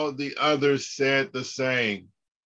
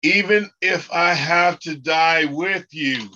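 louder, emphatic, stress on "Even"; emphatic lead line; 4 semitones higher, 5 dB louder than usual. Shown as a demonstration. Teacher leading students in repeating the verse, emphatic. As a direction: lead the class with a strong vow. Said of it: "repeat after me".

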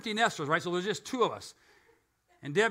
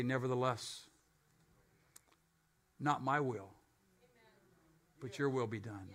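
quiet surrendered prayer; 8 semitones lower, 11 dB quieter than usual. Acted. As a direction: let the words fall quietly in surrender. Jesus praying in Gethsemane.

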